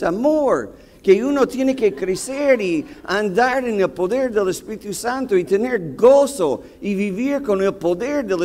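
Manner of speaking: agudo y sostenido, sermoneando al chofer; regaña al chofer como si predicara; reclamo exasperado. Retold or imitated. (regaño agudo al chofer que no paró)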